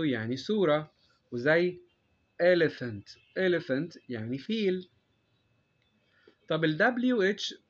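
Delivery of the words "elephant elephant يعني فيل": elephant said with an f sound, slowly; clear f in elephant. Shown as a demonstration. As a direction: say elephant with an f in the middle. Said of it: "بتتنطق اف". (نطق elephant بصوت الفاء)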